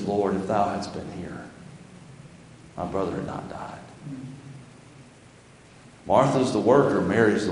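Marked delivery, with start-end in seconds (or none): 0.0-3.8 much softer, fading to a whisper; hushed, reverent grieving sister; worshipful whisper. Acted. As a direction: breathe it out in humble surrender. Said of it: "the different spirit between those two women".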